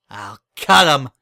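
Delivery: loud, fierce snap of a threat; snapped. Acted. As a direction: bark the threat out. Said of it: "snapped Berry".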